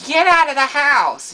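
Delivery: loud shrill mock yell from mom. Mom yelling at her son to get outside. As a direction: belt it like an angry mom. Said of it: "she'd be like".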